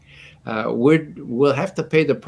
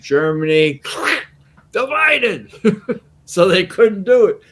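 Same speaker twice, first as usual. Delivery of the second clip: laughing; 9 semitones higher, 5 dB louder than usual. Real, not acted. Laughing at the irony.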